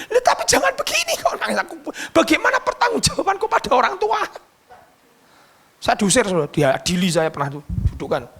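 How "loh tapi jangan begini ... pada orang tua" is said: high, fretful headmaster voice; worried headmaster. Retold or imitated. Headmaster fretting to the preacher about the parents, high and anxious.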